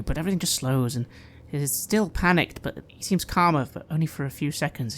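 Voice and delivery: ordinary delivery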